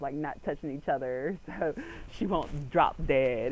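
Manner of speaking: ordinary delivery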